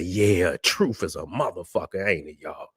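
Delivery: soft gloating under the breath; low knowing murmur. Real, not acted. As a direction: savor it quietly to yourself. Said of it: "I say".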